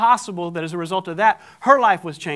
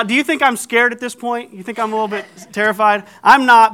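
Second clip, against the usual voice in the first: normal talking voice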